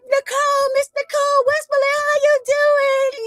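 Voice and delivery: high, gushing fan call. Retold or imitated. Reporter imitating fans greeting the attorney, high gushing call. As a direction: sing her name out like an adoring fan. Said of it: "They like".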